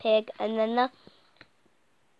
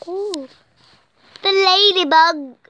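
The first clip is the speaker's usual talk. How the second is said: excited exclamation; 8 semitones higher, 11 dB louder, slower than usual. Acted. Excited reading to a child.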